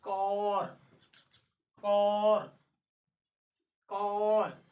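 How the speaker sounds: कौर said with a long open aw and no r; long aa demo. Shown as a demonstration. Teacher modelling the long vowel in कौर. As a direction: stretch the vowel of कौर, drop the r. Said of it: "बड़ा आ का उच्चारण कौर".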